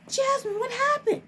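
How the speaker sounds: high-pitched alarmed imitation of the director; panicked director. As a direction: squeak it out like a worried boss. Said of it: "the director was just like".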